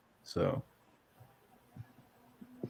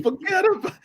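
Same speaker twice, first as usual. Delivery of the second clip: put-on "Forget about it." in a half-hearted New York accent, pitched higher with a laugh; mock New York accent; faster than usual. Quoting the wrestler's line in a mock New York accent. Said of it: "I don't have a New York accent, but that's how he said it".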